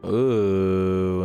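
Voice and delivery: ordinary talk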